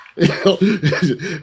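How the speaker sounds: a burst of loud laughter at his own joke. Real, not acted. Laughing loudly while mocking the bully's fat ego.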